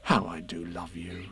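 low, very soft affection; tender. A narrator's reading. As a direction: confess it softly and fondly. Tender ending between friends.

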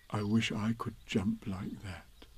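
slow, soft wistful Pooh; quiet, unhurried bear voice; Pooh thinking. Acted. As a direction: murmur it slowly to yourself. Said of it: "he thought".

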